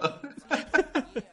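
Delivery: real high-pitched laughing fit; breathless high cackle of amusement; high helpless laughter. Real, not acted. Cracking up at a joke name.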